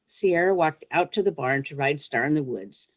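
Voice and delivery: ordinary delivery, nothing marked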